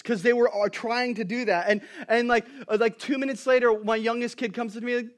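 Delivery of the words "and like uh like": normal talking voice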